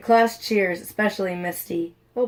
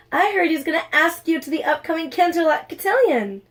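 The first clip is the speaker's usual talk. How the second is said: light gossipy friend; 8 semitones higher than usual. Acted. Snowwings gossiping.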